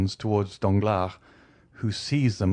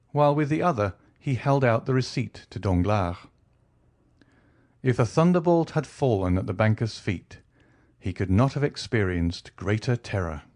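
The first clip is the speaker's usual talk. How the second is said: ordinary delivery, nothing marked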